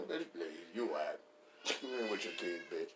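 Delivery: ordinary talk, nothing marked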